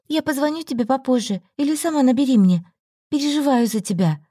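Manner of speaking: a worried friend leaving; caring friend. A narrator's reading. Olga voiced, caring farewell.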